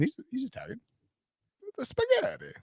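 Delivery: high, hammed-up cartoon Italian; a jokey video-game Italian; Mario accent. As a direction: squeak it out like Mario. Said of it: "more like a Mario accent".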